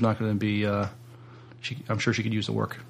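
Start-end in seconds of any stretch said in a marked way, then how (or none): none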